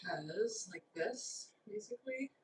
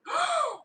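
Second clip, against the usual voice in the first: sharp gasp; 6 dB louder than usual. Real, not acted. Sees the cake inside.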